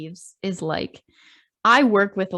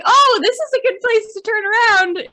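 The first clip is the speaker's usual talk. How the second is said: mock chirpy thought; 14 semitones higher, 8 dB louder than usual. Imitating her own thought aloud.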